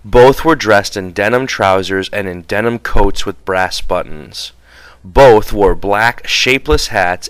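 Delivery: plain reading voice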